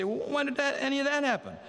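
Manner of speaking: high, incredulous question; mock baffled protest. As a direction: play them as puzzled and defensive. The condemned ask Jesus.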